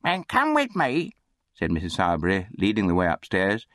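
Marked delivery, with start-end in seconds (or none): 0.0-1.1 high, slow command; bossy undertaker's wife. A narrator's reading. Undertaker's wife leads the boy upstairs, high bossy command. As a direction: order the boy along in a high voice.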